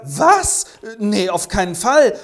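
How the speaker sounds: high, loud startled no; shocked refusal. Acted. Hoffmann asked for permits, refusing in shocked alarm. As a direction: recoil and refuse.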